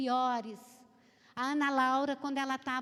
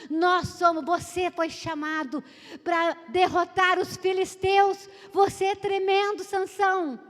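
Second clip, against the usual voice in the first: loud, rising preacher's proclamation; fiery pulpit call over the crowd; preaching at full force; 5 semitones higher, 10 dB louder than usual. Acted. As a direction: proclaim it to the whole church. Preacher declaring to congregation.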